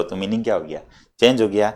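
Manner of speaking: ordinary talk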